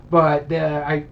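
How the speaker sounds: ordinary talk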